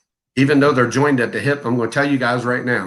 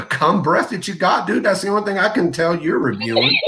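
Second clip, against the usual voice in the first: laughing; 8 semitones higher than usual. Real, not acted. Laughing at rival reviewers.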